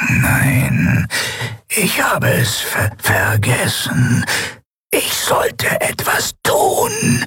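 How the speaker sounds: slow, breathless halting whisper that stumbles; a ruined machine groping for its lost task; whispering broken machine mask. Acted. Maske stammelt, bricht. (stockend flüsternde Maske, verwirrt)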